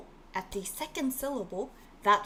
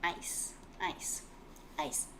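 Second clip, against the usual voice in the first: ice repeated softer each time, fading to a whisper; soft repetition; 4 semitones lower, 10 dB quieter, slower than usual. Shown as a demonstration. Teacher softly echoing ice for the class. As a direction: echo ice softly as a drill. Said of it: "얼음 means ice".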